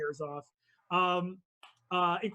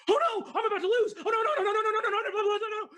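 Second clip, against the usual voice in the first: high, fast, laughing panic; comic high-pitched panic; panicking racer; 4 dB louder, much faster than usual. Acted. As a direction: squeal it out as she starts to lose. GM voices panicking racer Jothra.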